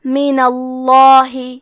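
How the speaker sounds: من اللہ with a thick, full ل in اللہ, slow; من اللہ recited slowly, thick ل; heavy lam. Shown as a demonstration. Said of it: "اللام کو موٹی آواز میں پڑھنا ہے".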